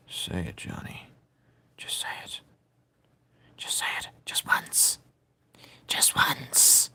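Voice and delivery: breathy, pleading whisper; sneaky little coaxing whisper. Real, not acted. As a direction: egg him on under your breath. Coaxing Johnny to say it.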